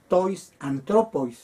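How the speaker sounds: "tois antropois" said slowly and carefully; slow classroom reading of "tois antropois"; slow Greek demo. Shown as a demonstration. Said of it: "Y para decir".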